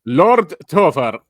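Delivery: loud, drawn-out, badly faked Scottish; slow mock-Scottish title; attempted Scottish accent. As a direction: botch a hammed-up Scottish accent. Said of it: "that's not Scottish".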